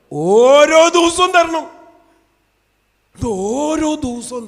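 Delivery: high, drawn-out full-force cry; preaching shout. Acted. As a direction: belt it out slowly, stretching every word. Preacher shouts the prayer line out.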